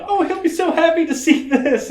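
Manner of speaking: high, sing-song delighted customer voice; gushing customer. Retold or imitated. Customer gushing over the new dog staircase, high mock delight. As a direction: gush like a thrilled pet owner.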